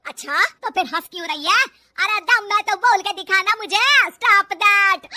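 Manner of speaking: very loud, challenging shout; mother-in-law yelling a challenge; angry dare. Acted. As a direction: goad her at full volume. Mother-in-law dares her angrily.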